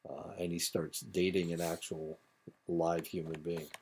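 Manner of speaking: ordinary delivery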